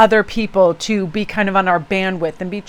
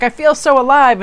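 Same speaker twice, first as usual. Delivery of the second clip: imitating excited swimmers, higher and louder; 6 semitones higher, 5 dB louder than usual. Young swimmers chatting after getting out of the water, excited. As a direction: call it out exhilarated, pitched up. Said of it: "they were that excited".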